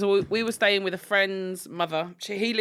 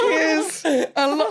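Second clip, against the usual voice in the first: slow, high drawn-out Cockney cheers with a laugh; imitating the Cockney thanks slowly, through laughter; 9 semitones higher, 4 dB louder, slower than usual. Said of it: "And he went".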